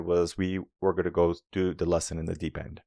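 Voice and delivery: usual speaking voice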